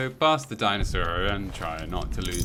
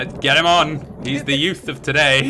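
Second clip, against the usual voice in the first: loud, higher excited cheer; excited TV producers; 6 semitones higher, 8 dB louder than usual. Retold or imitated. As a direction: cheer him on like thrilled show producers. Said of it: "and they're like".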